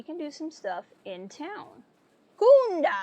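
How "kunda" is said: shouted high and loud; loud call. Real, not acted. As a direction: call it out with gusto. Shouting the place name.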